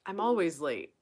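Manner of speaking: normal talking voice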